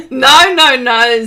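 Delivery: loud mock refusal from [name]; playful loud no. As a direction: bark the no's out for fun. Says [name].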